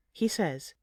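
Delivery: ordinary delivery, nothing marked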